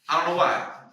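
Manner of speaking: loud, high Saint Louis slang. Retold or imitated. Showing the hosts how his cousin talks, loud Saint Louis voice. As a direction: crank it up like cousin Antoine. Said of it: "he's somebody who sound like this".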